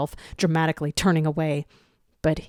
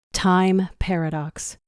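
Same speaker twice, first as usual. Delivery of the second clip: ordinary delivery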